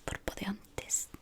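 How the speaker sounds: ordinary talk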